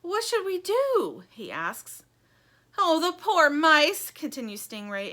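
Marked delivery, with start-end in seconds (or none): 0.0-1.2 high, slow frightened question; Lumpy voiced high and slow; anxious Lumpy. Lumpy asks. 2.8-4.1 high, loud and slow lament; grieving Stingray. Acted.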